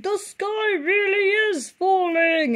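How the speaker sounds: high, slow panicked fox voice; shrieking fox. Acted. Foxy Loxy shrieks in panic as the chandelier falls. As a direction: wail it like a terrified fox. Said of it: "shrieked Foxy Loxy".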